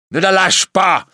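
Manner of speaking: loud, high, urgent command; shouted order. Acted. Priest ordering the recluse, shouting.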